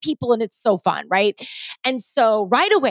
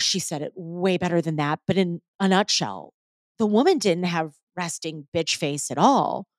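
usual speaking voice

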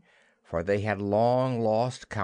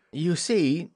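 higher, mildly apologetic opening; 7 semitones higher, 3 dB louder than usual. A narrator's reading. Peter explains himself to Wendy, apologetically. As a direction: begin with a touch of apology. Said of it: "he continued apologetically".

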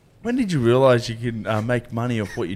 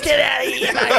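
mock high-pitched call; 13 semitones higher, 3 dB louder than usual. Mimics a mate shouting behind the bar.

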